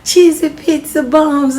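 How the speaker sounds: loud, high happy shout; excited yell. Acted. Little brother yelling about the treat, excited. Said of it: "Louise yelled".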